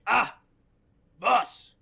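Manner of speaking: hammed-up henchman reporting in; acted henchman. Acting henchman calling to his boss.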